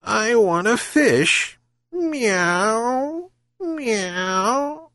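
high, slow meowing cat; purring cat. A narrator's reading. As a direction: purr the demand, stretching it out. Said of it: "she purred again".

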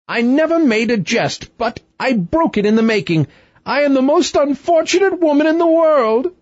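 very high-pitched lament; rueful wailing queen. A narrator's reading. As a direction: wail it as a self-pitying queen. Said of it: "She looked so rueful".

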